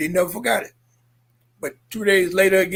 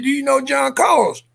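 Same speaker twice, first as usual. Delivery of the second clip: urgent retold questioning; 7 semitones higher than usual. Questioning father.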